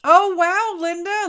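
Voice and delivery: high, loud oh wow; delighted surprise at the news; surprised. Real, not acted. Reacts to viewer's comment.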